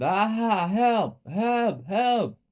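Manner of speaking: high, loud cries for help; screaming. A narrator's reading. Ted facing the whip, screaming for help. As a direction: scream for help in repeated bursts. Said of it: "Ted screamed".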